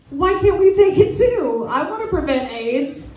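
high, slow indignant complaint; mock indignant. A woman protesting to the audience about pills, mock outraged and high. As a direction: demand it like it's an outrage.

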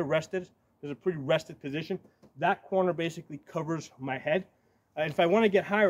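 ordinary talk

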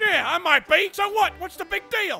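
barking drill sergeant berating a recruit; angry drill sergeant. Acted. Drill sergeant chewing out a player in the lobby. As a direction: play the foul-mouthed sergeant.

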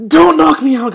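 high, loud cry of alarm; yelped, desperate protest; panicked plea. Real, not acted. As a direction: beg loudly not to lose. Pleads as he is knocked out.

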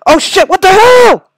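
high, loud shocked yell; startled yell. Real, not acted. Gamer caught off guard by the game, startled yell. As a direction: burst out in startled alarm.

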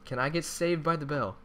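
usual speaking voice

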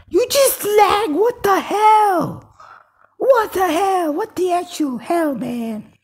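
high, loud, drawn-out scream of rage; furious screaming. Real, not acted. Gamer cursing the lag, screaming furiously. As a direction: scream it in outrage at the top of your range.

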